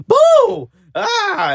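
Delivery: hammed-up shriek of Boo; Madea shriek. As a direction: screech it like Madea.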